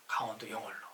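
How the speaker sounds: usual speaking voice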